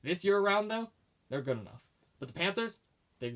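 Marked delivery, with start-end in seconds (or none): none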